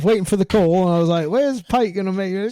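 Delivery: high, hammed-up Aussie; put-on Australian accent. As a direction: complain in broad mock Australian. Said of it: "That's pretty good".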